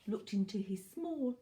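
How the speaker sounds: ordinary delivery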